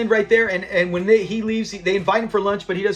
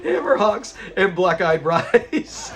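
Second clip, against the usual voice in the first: real laughter dragging the words out; laughing; slower than usual. Real, not acted. Host relishes the botched soul food line, laughing slowly through it.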